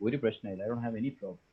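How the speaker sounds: low, soft, easygoing reply; unbothered. Acted. Second person in the queue shrugging off the delay, unbothered. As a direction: play it untroubled, voice dropped low and quiet. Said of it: "His attitude and behavior is like".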